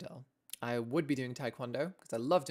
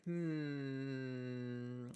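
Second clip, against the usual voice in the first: pondering examiner; 5 dB quieter than usual. Pretend. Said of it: "And they're like".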